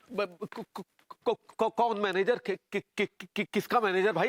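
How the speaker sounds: stuttering on the first sounds; flustered stammer. Acted. Man denies being her manager, flustered stammer. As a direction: stumble repeatedly into each question.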